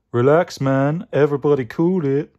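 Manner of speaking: groovy cool-dude character voice; Mystic Mango. Acted. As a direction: play a hippie telling everyone to relax. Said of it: "It's Mystic Mango".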